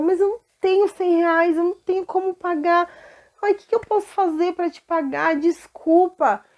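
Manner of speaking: high, desperate cries of a debtor; panicked pleading servant. Acted. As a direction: beg the king for mercy, voice climbing. Friend begs the servant. (servo desesperado implorando ao rei, com a voz aguda)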